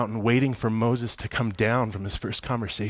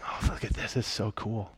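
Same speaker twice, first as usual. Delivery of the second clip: imagined soft, breath-light marvelling; hushed wonder; 7 dB quieter than usual. Retold or imitated. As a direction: marvel under your breath, playing the part. God marvelling on a walk.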